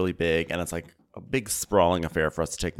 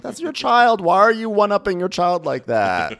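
indignant; 12 semitones higher, 6 dB louder than usual. Real, not acted. Indignant outburst.

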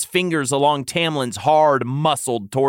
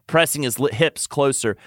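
usual speaking voice

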